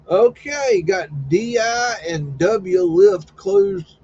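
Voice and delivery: slow, loud call-out of the place name; loud announcing. Real, not acted. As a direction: announce it like a tour guide, drawn out. Reading out a map location.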